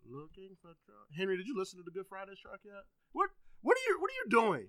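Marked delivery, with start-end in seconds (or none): 3.1-4.7 high, loud, incredulous; exasperated outburst. Real, not acted. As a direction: call him out in disbelief. Exasperated at Henry's YouTube browsing.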